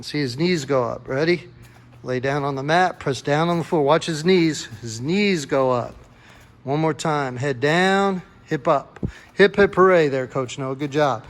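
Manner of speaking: ordinary talk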